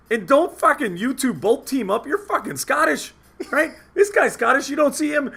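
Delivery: high, heated mock protest; retold argument. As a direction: re-enact telling off two friends.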